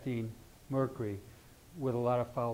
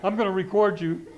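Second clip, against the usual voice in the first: loud, raised-pitch mock threat; playful raised-voice imitation of a wife; imitating wife's threat; 6 semitones higher, 9 dB louder than usual. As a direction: quote the wife loudly, amused. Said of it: "she's actually said that".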